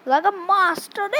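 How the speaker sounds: ordinary delivery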